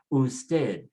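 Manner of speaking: Usted with a weighted TED; stress on last syllable. Shown as a demonstration. Teacher models the second rule, stressing Usted on TED. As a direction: say Usted, leaning on the final syllable. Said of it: "put the stress on the last syllable".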